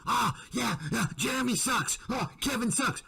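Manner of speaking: high mock voice of a fake-feuding youtuber; sarcastic high-pitched parody of rivals pretending to trash each other; mocking the gang. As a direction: parrot them high and sarcastic, like it's all a joke.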